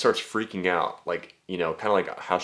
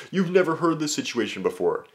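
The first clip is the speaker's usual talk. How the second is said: mock asker; 7 semitones higher than usual.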